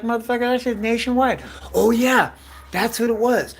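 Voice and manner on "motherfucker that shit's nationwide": fast, high-pitched exclamation; excited outburst. Retold or imitated. Telling friends the rumor was everywhere, fast high excited blurt. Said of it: "I was like".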